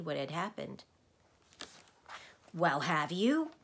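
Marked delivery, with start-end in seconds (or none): 2.5-3.5 slow, loud raspy demand; raspy insisting. Acted. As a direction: press him, croaking slowly. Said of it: "insisted the voice".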